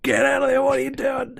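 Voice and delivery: high, strained mock plea, held up in pitch; imitating desperate Sam. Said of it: "he just drops to his knees".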